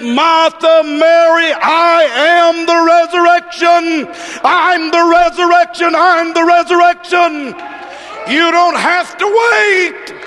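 high, ringing preacher's shout; climactic preached proclamation. Retold or imitated. Preacher voicing Jesus answering Martha and Mary, high ringing shout. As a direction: cry it out on a sustained high pitch.

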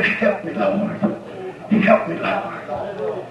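soft, breathy, slow prayer of Help me, Lord; whispered plea. Shown as a demonstration. A preacher showing the congregation a hushed plea. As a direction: pray it under your breath, drawn out.

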